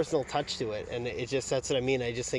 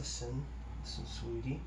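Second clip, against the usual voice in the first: much softer cooing to a pet; tender; 10 dB quieter than usual. Real, not acted. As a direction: gently soothe the kitty. Soothing the cat.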